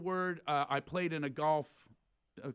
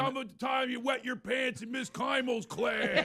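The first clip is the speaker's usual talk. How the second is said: put-on drunk heckler; 8 semitones higher than usual. Drunk friends heckle from aisle.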